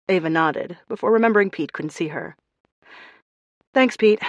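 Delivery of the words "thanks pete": loud phone voice. A narrator's reading. Speaking to a phone.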